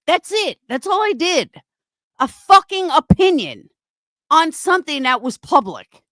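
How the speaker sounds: high-pitched angry outburst; outraged, high voice in a furious rant; real fury. Real, not acted. Angry protest at being blamed.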